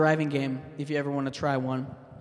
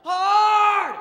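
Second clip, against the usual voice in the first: very high, very loud yell; curling skip yelling at sweepers; shouted call; 9 dB louder than usual. Retold or imitated. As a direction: bellow it across the ice like a curling skip. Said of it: "he'll just yell".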